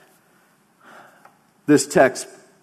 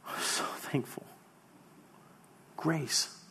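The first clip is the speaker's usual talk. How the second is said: whispered thanks; 3 semitones higher, 16 dB quieter, slower than usual. Retold or imitated. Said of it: "they go from tears to".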